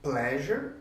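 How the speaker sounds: normal talking voice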